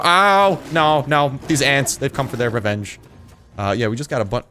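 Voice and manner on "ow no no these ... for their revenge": loud, high yelp of alarm; startled, protesting shout; alarmed outcry. Real, not acted. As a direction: cry out as if suddenly attacked. Ants suddenly attack in game.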